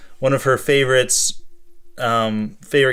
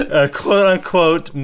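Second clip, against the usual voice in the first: laughing; 7 semitones higher than usual. Real, not acted. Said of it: "how to do quotes with a sock puppet".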